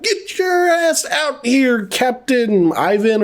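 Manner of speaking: high, drawn-out call without real volume; mock calling out. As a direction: call into a cave, stretching each word. Calling into cave in retelling.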